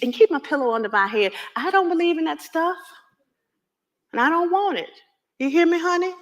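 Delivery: dying mother insisting on her wishes; urgent insistence. Acted. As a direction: demand to be obeyed.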